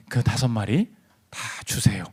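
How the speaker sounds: low, soft, measured pastor's voice; acted calm pastoral counsel. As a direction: play the unruffled pastor, low and hushed. Same pastor continues his counsel.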